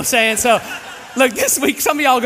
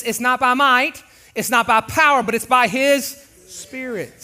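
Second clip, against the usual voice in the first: scripture reading; 5 semitones higher, 4 dB louder than usual. Acted. Reads Zechariah scripture.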